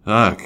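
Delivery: loud shuddering ugh of disgust. A narrator's reading. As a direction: recoil with a loud shudder of revulsion. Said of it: "shuddering at the recollection".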